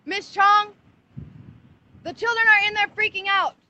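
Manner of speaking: high, urgent cry for help; frantic alarm. Real, not acted. Mother appealing to bystanders about children trapped in cars, real frantic alarm. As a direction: raise the pitch and rally everyone nearby.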